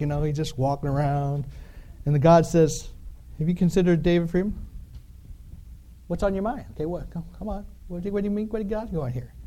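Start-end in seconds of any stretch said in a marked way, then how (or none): 3.4-9.3 lightly voiced, casual God nudging Satan; a hint of God teasing, softer and quick.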